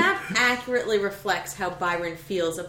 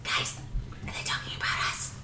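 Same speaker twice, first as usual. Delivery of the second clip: hushed, breathy whisper; 9 dB quieter than usual. Acted. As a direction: whisper it so nobody hears. Hushed worry while eavesdropping.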